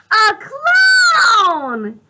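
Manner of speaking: very high, loud cry of joy; delighted shout. Acted. Gerald seeing Piggy the clown, loud delighted shout. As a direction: shout it out, thrilled. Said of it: "Gerald says".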